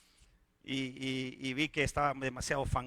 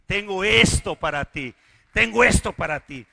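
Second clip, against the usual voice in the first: building promise; 2 semitones higher, 10 dB louder than usual. Acted. God's promise, building repeat.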